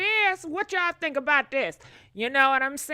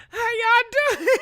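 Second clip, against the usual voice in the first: higher, louder, laughing through the words; giggling how y'all doing; laughing greeting; 10 semitones higher, 6 dB louder than usual. Real, not acted. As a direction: greet them while cracking up.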